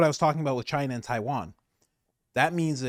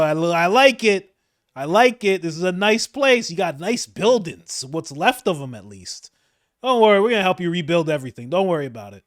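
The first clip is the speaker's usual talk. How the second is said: mock cheery official; 6 semitones higher, 9 dB louder than usual. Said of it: "See that Howard guy".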